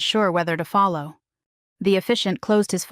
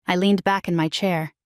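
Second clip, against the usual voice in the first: plain reading voice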